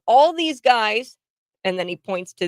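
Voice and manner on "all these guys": slow, higher, louder retold complaint; irate bystander impression. Host quoting the Brit pointing at blocked traffic, mock indignant. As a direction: draw it out, pitched up in protest. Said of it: "And he goes".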